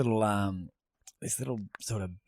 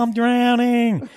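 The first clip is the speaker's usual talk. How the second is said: put-on child yelling; 11 semitones higher, 8 dB louder, much slower than usual. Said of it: "And he's going".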